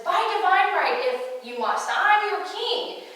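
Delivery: high, loud royal assertion, stretched at the end; indignant outburst. Retold or imitated. Birdie claims divine right over Lionel, indignant. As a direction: pull rank hotly and loudly.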